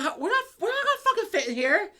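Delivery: high, loud laughing disbelief; retold protest. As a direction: object in comic alarm. Retelling protest at the small car.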